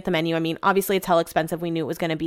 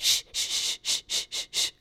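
soft whispered shh, shh; whispered shushing; 10 dB quieter than usual. Retold or imitated. Telling tipsy husband to hush, whispered shushing. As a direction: hush him under your breath.

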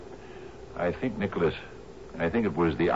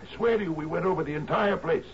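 much higher, pleading voice; desperate plea; 13 semitones higher than usual. Acted. Captain swearing to Murdoch, high desperate pleading. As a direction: beg him to believe you.

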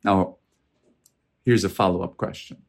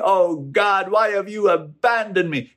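high-pitched cry of complaint to God; complaining; 9 semitones higher than usual. Acted. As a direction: plead upward in mock complaint, voice raised high. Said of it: "that are complaining".

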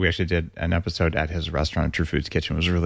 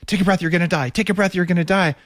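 a higher, nagging retold inner voice; mock nagging inner voice, pitched up; dumb little inner voice; 10 semitones higher than usual. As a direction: mimic the pestering voice in your head. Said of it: "some dumb little voice in my head saying".

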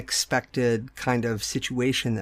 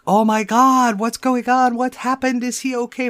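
high, loud panicked gasping questions; imitated panic; 10 semitones higher, 7 dB louder than usual. Mother imagining she panics at the crash news, high and loud. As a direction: act out the mom who falls apart. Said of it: "I didn't fall apart".

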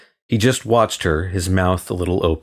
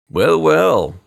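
high and louder, breezily mocking; airy; 10 semitones higher, 4 dB louder than usual. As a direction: toss it off airily, pitched up. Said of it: "the clerk said airily".

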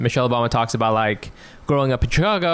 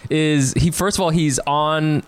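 usual speaking voice

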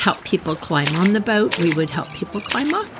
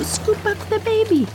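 high-pitched rescue plea; retold urgency, pitched way up; acted urgent cry; 13 semitones higher than usual. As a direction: urge a quick rescue in a high voice. Acting out rescue of the chick.

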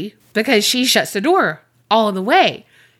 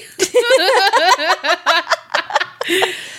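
long, high-pitched cackle; helpless laughter; 9 semitones higher than usual. Real, not acted. Reacting to teapot laughs comment, cackling. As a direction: crack up at length. Tickled by listener's letter.

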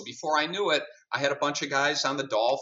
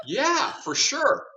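high, slow, stretched-out delighted yes; eager yes; 5 dB louder, much slower than usual. Retold or imitated. To the athlete asking him to represent him, delighted. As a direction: grab the offer with a thrilled, drawn-out yes.

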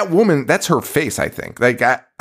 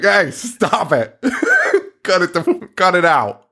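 mock scolding; 7 semitones higher than usual. Mimicking guy scolding couple.

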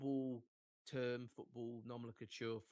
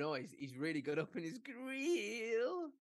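slow, high drawn-out pundit line; playful slow pundit voice; mock pundit praise; 9 semitones higher, slower than usual. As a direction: ham up a commentator's rave. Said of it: "I just would like people to start going".

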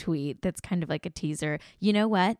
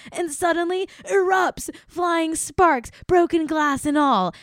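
dramatic build-up; 10 semitones higher, 6 dB louder than usual. Acted. Reading fans a quote about a TV exploding, mock-dramatic. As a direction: voice climbing higher and louder.

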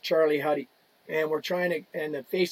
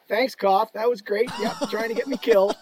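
louder, mock-grateful jab; sarcastic thanks; 5 semitones higher, 4 dB louder than usual. Player mocking the teammate who threw him a suicide pass, sarcastic. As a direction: thank him while meaning the opposite. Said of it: "give him the old Harry Stink eye".

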